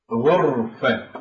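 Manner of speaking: غرفة said slowly with a thick ra; heavy ra demo. Shown as a demonstration. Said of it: "فهنا الراء ساكنة ولكن جاءت بعد الغين المضمومة".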